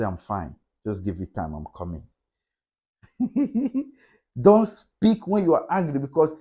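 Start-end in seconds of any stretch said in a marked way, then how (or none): 0.0-2.0 low, much softer, trailing away; murmured I'm fine from the couch; quiet withdrawn reply. Shown as a demonstration. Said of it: "Do like me". 3.2-3.8 short laugh. Real, not acted. Laughs at own story.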